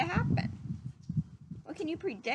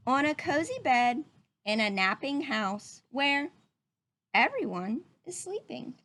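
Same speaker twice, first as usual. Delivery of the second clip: put-on reading to children; 3 dB louder than usual. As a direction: read it gently to little listeners. Reading picture book to children.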